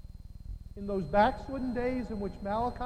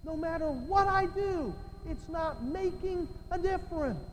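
high, raised-pitch frustration; exasperated cry; 8 semitones higher than usual. Acted. Preacher voicing a believer who feels useless, high and exasperated.

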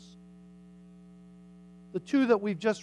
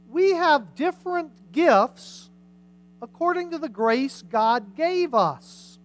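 raised, higher-pitched public Bible reading; scripture reading; 6 semitones higher, 4 dB louder than usual. Acted. Preacher reading Romans to the congregation, emphatic scripture reading. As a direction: proclaim each gift and its charge in measured parallel phrases.

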